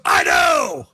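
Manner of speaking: high, loud mock shout; acted Swerve admission. Swerve shouting his admission at Hangman. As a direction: blurt it out as Swerve. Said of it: "And Swerve goes".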